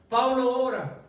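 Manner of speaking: high and loud, a preacher's cry; preaching emphasis. Real, not acted. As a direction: lift it up and proclaim it to the church. Preacher building to a climax. (proclamación en voz alta y aguda)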